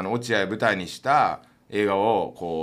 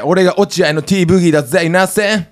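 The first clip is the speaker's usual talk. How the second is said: hammed-up rapper intro; 7 semitones higher, 11 dB louder than usual. Mock rapper self-intro.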